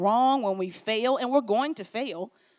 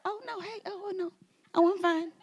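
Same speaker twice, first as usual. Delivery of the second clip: mock denial; 8 semitones higher than usual. Acting fake denial.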